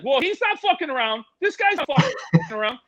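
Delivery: high-pitched excited actor impression; Vince Vaughn, excited. Actor marvels at the snake handler's hooks, high and excited. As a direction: rave like an amazed movie star, voice way up. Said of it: "Vince Vaughn's like".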